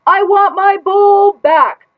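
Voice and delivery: high, loud, slow furious scream. Acted. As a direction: scream it, fed up with everyone. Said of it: "I screamed".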